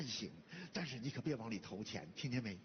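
very low fatherly counsel; confidential warning. Acted. Father privately warns son about money.

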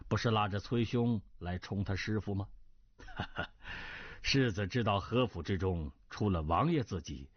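low-pitched courtly reassurance with chuckles; smooth it over with a polite laugh; genial diplomatic marquis. Acted. Marquis telling Cui his identity was long known, genial.

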